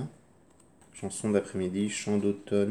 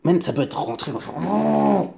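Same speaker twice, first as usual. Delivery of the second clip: intense; 10 semitones higher, 7 dB louder than usual. Shown as a demonstration. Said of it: "c'est pas forcément fort".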